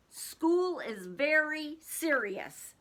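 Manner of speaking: slow, serious farmer; solemn, drawn out. Acted. Farmer warning chickens, slow and solemn. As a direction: make school sound very serious.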